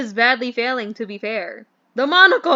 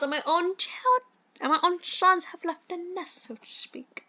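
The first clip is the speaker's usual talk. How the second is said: posh British gentleman. Acted. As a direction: play the fussy English employer in a mild posh accent. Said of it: "I hate this accent".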